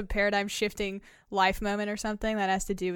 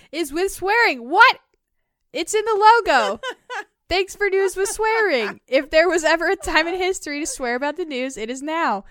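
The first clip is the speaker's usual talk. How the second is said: higher, louder, excited reading; 7 semitones higher, 7 dB louder than usual. Acted. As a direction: read it out with glee.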